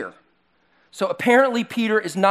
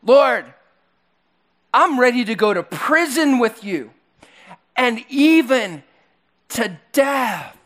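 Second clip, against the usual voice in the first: cocky Peter; 5 semitones higher than usual. Retold or imitated. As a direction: brag like an overconfident disciple. Pastor voicing boastful Peter.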